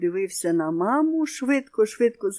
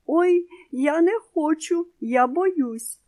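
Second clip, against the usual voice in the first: high, scared little bunny whimper; whimpering fear; 9 semitones higher, 4 dB louder, slower than usual. A narrator's reading. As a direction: whine it like a frightened child. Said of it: "здригнулося і заскиглило". (високе злякане скиглення)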